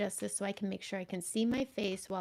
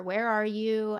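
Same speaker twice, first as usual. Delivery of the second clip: ordinary talk, nothing marked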